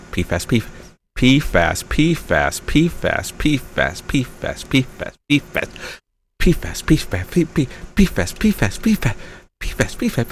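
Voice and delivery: quiet fast tongue-twister drill; tongue-twister speed run. Shown as a demonstration. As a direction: rattle it off as fast as you can. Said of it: "can you say it?".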